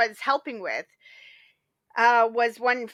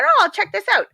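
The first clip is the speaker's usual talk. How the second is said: bright, eager inner voice; excited thought; 9 semitones higher, 7 dB louder than usual. Retold or imitated. To herself about the drama, eagerly. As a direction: perk up at the idea.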